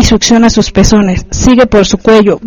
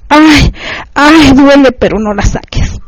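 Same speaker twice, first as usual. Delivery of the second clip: mild acted cry of pain; higher ay, then easing off; 4 semitones higher than usual. A narrator's reading. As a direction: cry out, then drop back. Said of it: "Mi mujer grita".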